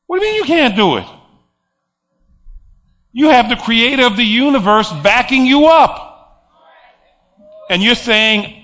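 ordinary talk, nothing marked

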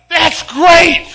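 high, loud enthusiastic encouragement; excited cheer. Retold or imitated. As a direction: pump him up. Grandpa cheers the grandson on.

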